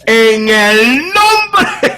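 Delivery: very loud, high, drawn-out Pentecostal invocation; authoritative prayer shout. Retold or imitated. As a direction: open your mouth and bellow the prayer, slowly. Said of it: "ore con autoridad y abra la boca". (grito pentecostal de oración, agudo y alargado)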